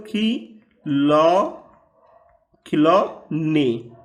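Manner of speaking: खिलौने stretched out very slowly; dictation pace. Shown as a demonstration. Teacher dictates खिलौने slowly for pupils to write. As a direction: drag each sound so children can write it.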